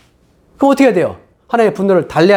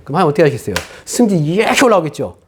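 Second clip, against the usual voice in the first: ordinary talk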